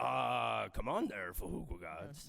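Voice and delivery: very low, deep coach voice; a deep put-on coach. Shown as a demonstration. Joining the riff in a deep coach voice. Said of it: "It's funny that you did that voice".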